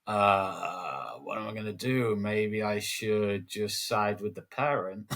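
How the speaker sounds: slow, drawn-out pondering; hammed-up indecisive head teacher; mock dithering. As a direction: drag it out like a wavering boss. Acts head dithering over a complaint.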